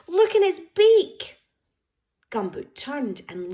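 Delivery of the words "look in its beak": high, loud, slow excited call. A narrator's reading. The little girl calling to Gumboot, a high, loud excited call. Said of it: "calling and pointing excitedly".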